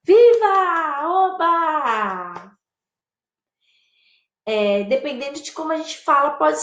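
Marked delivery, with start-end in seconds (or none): 0.0-2.5 delighted cheer. Shown as a demonstration. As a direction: celebrate with stretched vowels. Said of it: "Satisfação".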